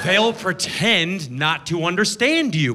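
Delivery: normal talking voice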